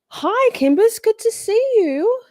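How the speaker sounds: high, loud, drawn-out welcome; effusive greeting. Real, not acted. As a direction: sing out a delighted hello to a friend. Greeting an arriving viewer effusively.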